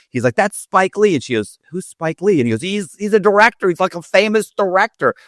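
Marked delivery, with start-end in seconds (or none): 0.4-1.2 high, incredulous exclamation; urgent disbelief. Retold or imitated. Husband telling his oblivious wife who that is, urgent. 1.7-2.4 higher-pitched puzzled question; lightly voiced clueless wife. Wife asking husband about the stranger, mildly clueless. 2.6-5.1 high, insistent imitation of a husband; exasperated explaining. Embarrassed husband explaining celebrity to wife, exasperated.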